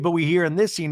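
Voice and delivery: ordinary talk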